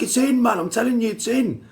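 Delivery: very high, loud excited yell; excited shout. Retold or imitated. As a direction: shout it across the green. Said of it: "They was like".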